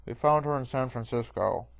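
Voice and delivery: guard through helmet. A narrator's reading. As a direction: report it firmly from inside a helmet. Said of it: "says through his helmet".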